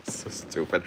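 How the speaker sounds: normal talking voice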